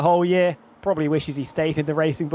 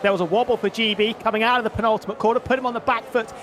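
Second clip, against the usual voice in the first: higher-pitched running play-by-play; urgently track each move as it happens; live race commentary; 5 semitones higher than usual. Real, not acted. Co-commentator calling wobbles and passes after the restart, animated play-by-play.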